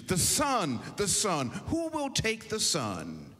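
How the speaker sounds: auctioneer raises his voice, rising high on the last call; calling out to the room. A narrator's reading. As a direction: call it out over the crowd, pleading for a bidder.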